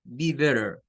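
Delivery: "Be better" with the T as a quick R tap; native-sounding "Be better", T flapped like R; American flapped T. Shown as a demonstration. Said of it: "díganlo como la R". ("Be better" con T como R, estilo nativo)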